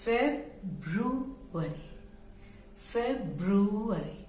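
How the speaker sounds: फेब्रुअरी split into three parts, feb-ru-ary; फेब्रुअरी stretched out, not clipped to फरवरी; February in three parts. Shown as a demonstration. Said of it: "हम तीन में यदि बांट लेंगे".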